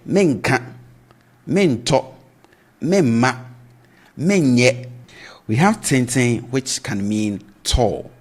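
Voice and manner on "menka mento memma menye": menka, mento, memma, menye each started high; spoken future negatives. Shown as a demonstration. Teacher listing spoken future negatives to learners. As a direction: run the forms with a raised first syllable, slowly. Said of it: "but in speech, you hear".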